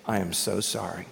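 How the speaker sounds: sheepish, hushed sorry; wife apologizing to the desk lady, softly; embarrassed apology. Retold or imitated.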